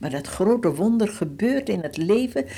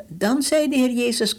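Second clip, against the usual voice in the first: ordinary talk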